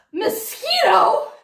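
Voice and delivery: mock gasp of realization. Daughter realizes the truth, hammed-up gasp. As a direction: gasp as it dawns.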